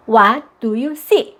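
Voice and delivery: put-on drawn-out, very slow picture-book question to a child; slow read-aloud question. Shown as a demonstration. Parent reading to a toddler, slow and drawn out. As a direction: stretch each word for the little ones. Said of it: "첫 번째는 문형".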